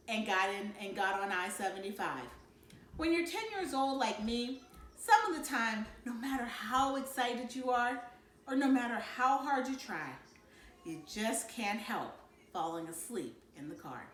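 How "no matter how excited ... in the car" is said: usual speaking voice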